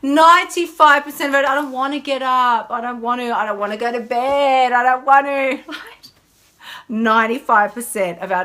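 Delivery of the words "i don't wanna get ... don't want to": put-on high, louder whining child; whiny voice. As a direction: whine like a sulky kid. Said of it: "my whiny voice".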